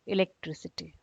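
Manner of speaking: ordinary talk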